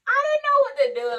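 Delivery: high, loud exclamation; hammed-up flustered outburst; panicked. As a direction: wail it in comic helplessness. Reliving her panic in trauma bay.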